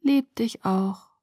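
slow, sleepy murmur; drowsy. Acted. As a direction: drift off while answering tenderly. Drowsy answer to whispered love.

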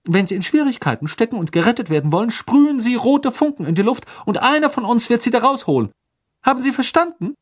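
high, fast stern teacher; McGonagall voice. A narrator's reading. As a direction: briskly lay down the rules. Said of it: "sagte Professor McGonagall".

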